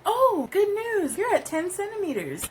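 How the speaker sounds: mimicking a cheery nurse delivering news to the mother; imitating the nurse. Nurse quoted in birth story.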